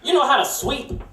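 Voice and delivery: imitating the boss. Boss voiced in story.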